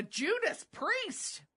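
high, slow, stretched-out exclamation; imitating grandma's exclamation. Quoting what grandma always said, high drawn-out exclamation. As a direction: draw out grandma's mild oath. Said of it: "my grandma would say all the time".

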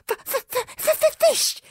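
high stuttering dragon hiss; squeaky dragon hissing, stuttering; stammering little dragon. Acted. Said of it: "hissed Toothless".

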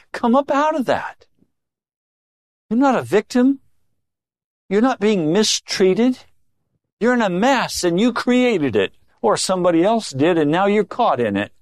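high-pitched, emphatic correction; urgent preacher's reproof, pitched well up; insistent rebuke. Acted. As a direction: confront them and rouse them from self-pity. Voicing Jesus rebuking the self-pitying.

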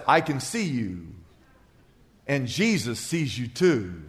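low, hushed voice from the dark corner; a hidden voice spooking the burglar in the dark; eerie voice in the dark. Acted. A voice in the pitch dark speaking low to the burglar, eerie. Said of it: "he hears a voice that says".

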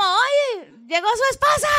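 llamado agudo imitando un grito; voz muy alzada, como avisando desde la escalera; grito de aviso. Retold or imitated. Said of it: "le gritó como". (grito agudo de aviso, imitado)

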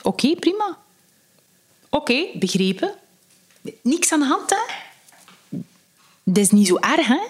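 high, lilting brief replies; easygoing acted reply. Accepting someone's objection to a joke, easygoing and high-pitched. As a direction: say it lightly, lifting the pitch on each short phrase.

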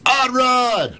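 high, loud radio show shout; shouted sign-on. Real, not acted. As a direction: belt out the show tag at full volume. Show host calling out his tag.

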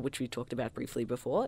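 usual speaking voice

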